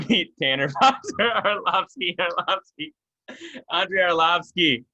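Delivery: high, loud, helpless laughing through the name; laughing hard. Real, not acted. Co-host stumbling over a fighter's name, laughing hard. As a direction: keep trying to say it while cracking up. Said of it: "I'm laughing so hard".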